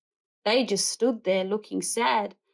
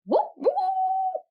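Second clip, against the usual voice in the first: very high trumpeting whoops; elephant trumpeting. A narrator's reading. Elephant trumpeting before grabbing sandwich, high whoops. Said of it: "made a loud trumpeting noise".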